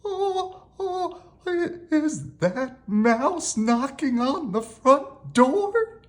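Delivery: high, slow, hesitant fright, voiced; frightened whisper. A narrator's reading. Rabbit asks who is knocking, frightened voiced whisper. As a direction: stammer it in a high, nervous voice. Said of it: "he whispered".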